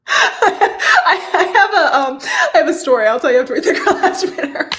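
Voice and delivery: can barely get it out for laughing. Real, not acted. Host laughing as she teases her story, giggly.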